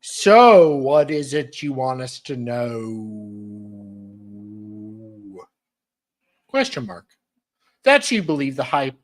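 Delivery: loud, theatrical read-aloud of the message; dramatic reading. Acted. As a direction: declaim every word like evidence.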